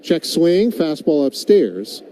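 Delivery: ordinary delivery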